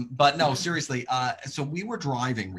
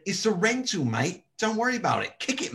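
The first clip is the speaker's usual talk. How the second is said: cheerful higher Aussie voice, rising on the last "Kick it"; mock Aussie bloke; 6 semitones higher than usual. Aussie stranger urging her to kick the car, jaunty Australian.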